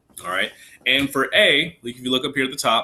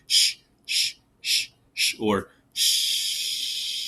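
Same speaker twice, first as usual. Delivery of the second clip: shushing the beats; much slower than usual. Shown as a demonstration. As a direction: hush out each rest beat. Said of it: "we count for four".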